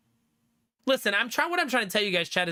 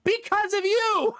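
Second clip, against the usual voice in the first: high, slow, accusing; high mocking wife voice; 3 dB louder, slower than usual. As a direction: drag it out high and pointed. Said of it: "And she goes".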